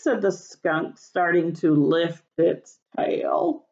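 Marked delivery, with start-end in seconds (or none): none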